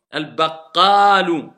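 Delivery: Al-baqalu slow and loud, long vowel held; corrected reading. Shown as a demonstration. Teacher correcting the student, slow and loud. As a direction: stress the mad in Al-baqalu. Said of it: "il y a un mad ici ou pas".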